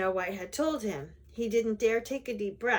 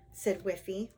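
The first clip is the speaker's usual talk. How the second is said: ordinary delivery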